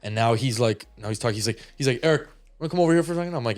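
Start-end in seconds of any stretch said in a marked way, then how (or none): 2.0-3.3 fast, higher call for the son; quick call to son. Retold or imitated. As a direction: rattle off dad's request. Dad calling son over.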